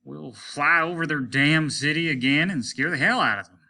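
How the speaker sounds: the captain swearing loudly; blustering threat. Acted.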